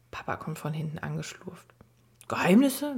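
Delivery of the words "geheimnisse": curious surprise. A narrator's reading. Papa overhears the secret.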